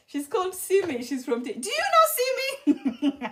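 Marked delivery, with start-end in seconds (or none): 1.5-3.2 high, drawn-out question breaking into laughter; giggly, high-pitched joking question; laughing question. Real, not acted. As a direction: ask it through rising laughter.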